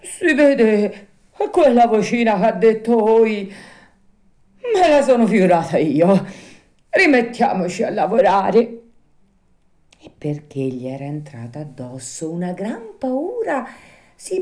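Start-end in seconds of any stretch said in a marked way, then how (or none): 0.0-8.8 nervous bravado. A narrator's reading. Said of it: "sforzandosi di ridere".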